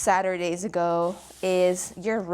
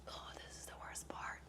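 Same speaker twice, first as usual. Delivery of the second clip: whispered groan; 26 dB quieter than usual. Real, not acted. Groaning before a hard set.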